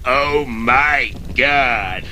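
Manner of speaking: low, very slow horrified medic; appalled medic. Acted. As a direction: draw out each word, low and aghast. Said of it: "the medic gets out and says".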